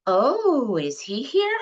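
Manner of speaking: mishearing desk as a person, puzzled; confused mother. Acted. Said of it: "Brett and Mom in Assisted Living".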